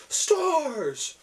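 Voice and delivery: high yelled shout; arena yell. Retold or imitated. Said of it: "the entire arena yells".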